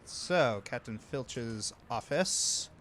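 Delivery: ordinary talk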